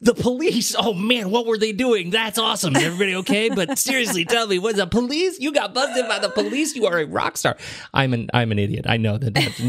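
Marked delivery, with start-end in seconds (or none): 0.0-7.6 much higher, gleeful thrilled dad; adolescent excitement. Retold or imitated. Dad cheering his son's police bust like a teenager. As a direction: gush like a teen hearing gossip. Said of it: "incapable of behaving like a rational parent".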